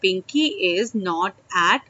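slow demo. Shown as a demonstration. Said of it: "तो हम यहाँ बोल रहे हैं".

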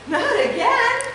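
high, loud hammed-up wail; mock dismay. Unicorn seeing his hooves turn gray again, mock wail. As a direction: cry out in cartoon despair. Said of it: "cried the unicorn".

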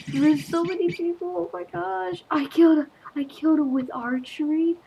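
high, excited disbelief; frantic astonishment. Real, not acted. Player making a surprise archery kill, astonished. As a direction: exclaim it high, amazed at yourself.

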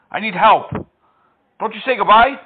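usual speaking voice

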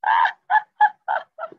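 high-pitched giggle; high laugh. Real, not acted. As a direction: squeal of laughter. Laughing at the story.

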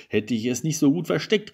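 reproachful call. A narrator's reading. Husband blames wife for the lost present, reproachful call. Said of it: "rief der Mann".

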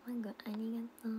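breathy quick whispered thanks. Real, not acted. Thanking a viewer in a fast whisper. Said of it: "whispered thanks to viewer".

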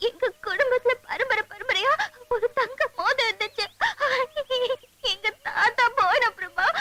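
tale told in broken sobs; crying. Acted. Telling a stranger about the lost ring, crying. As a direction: sob between phrases as you explain. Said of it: "அழுகாதீங்க".

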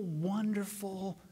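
usual speaking voice

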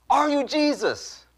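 high, slow, drawn-out imitated demand; insistent question. Woman insisting on her question.

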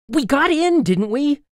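high, pleading insistence; agitated wail. Acted. Boy searching for a way out, agitated plea.

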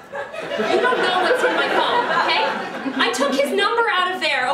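defensive protest. Acted. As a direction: argue back heatedly. Defensive argument with the dog.

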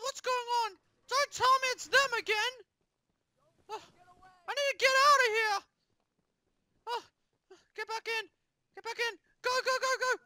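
ordinary delivery

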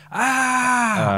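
high mock scream of fright. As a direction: let out a short hammed-up scream. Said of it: "you can just literally just yell".

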